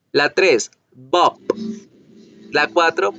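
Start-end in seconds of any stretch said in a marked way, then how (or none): 0.9-1.4 bop with a voiced b and a bursting p; English bop. Shown as a demonstration. Teacher models bop the English way, contrasting the b and p. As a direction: buzz the b, pop the p in bop. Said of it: "como se debe pronunciar en inglés".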